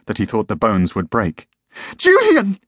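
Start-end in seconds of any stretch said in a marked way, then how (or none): 2.0-2.6 very high, loud shriek of a name; scream. A narrator's reading. Wife seeing the pistol, screamed name. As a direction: scream out in alarm. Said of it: "she screamed".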